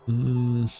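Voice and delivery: ordinary talk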